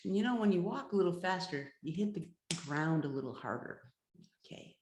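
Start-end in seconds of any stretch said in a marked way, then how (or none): none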